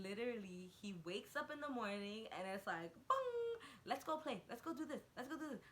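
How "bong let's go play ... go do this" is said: higher, bouncy little-kid voice imitating her son; eager toddler. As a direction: mimic a toddler raring to play. Son's eager morning wake-up.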